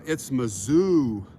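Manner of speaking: Mizzou drawn out slowly, stress on zou. Retold or imitated. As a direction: say Mizzou, long and slow. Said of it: "it's not even Missouri".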